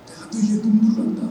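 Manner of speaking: whispered tenderly. Retold or imitated. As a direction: breathe it like a secret comfort. God's words retold, tender.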